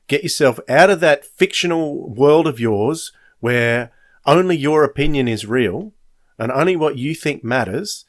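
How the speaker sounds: usual speaking voice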